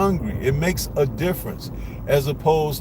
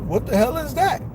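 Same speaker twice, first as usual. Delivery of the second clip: exasperated outburst; 10 semitones higher than usual. Real, not acted. Vlogger scoffing at a fighter who hurts sparring partners, incredulous. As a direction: pitch jumps high on an incredulous question.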